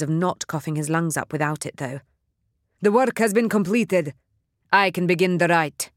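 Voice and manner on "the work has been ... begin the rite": loud proclamation. Acted. Leader announcing to followers.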